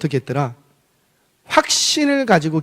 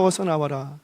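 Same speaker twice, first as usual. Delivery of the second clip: softer repeat; 3 semitones lower, 9 dB quieter than usual. Acted. Repeat of shouted call, dropping away.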